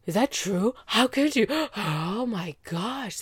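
gasping, hammed-up disbelief; mock shocked. Friends react to the revealed secret, mock shocked. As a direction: act scandalised, gasping between phrases.

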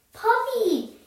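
high, smiling exclamation; delighted. A narrator's reading. Small boy sees Teddy the dog, delighted squeal of greeting. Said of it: "with a huge smile".

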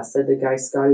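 ordinary delivery, nothing marked

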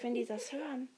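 ordinary talk

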